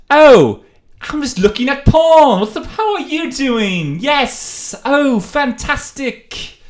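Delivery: very high, loud British comic impression; drawn-out exclamations in a showy British accent; Russell Brand. Showing off an impression of a comic watching porn at a library, high and loud. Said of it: "Can I do Russell Brand".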